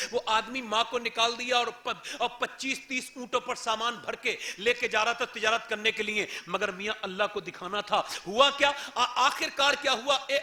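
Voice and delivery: ordinary delivery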